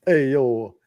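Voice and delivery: put-on a light New York Queens accent, hey yo style; lightly voiced Queens guy cheering his batter; half-hearted New York Queens accent. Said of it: "can I do a Queens accent".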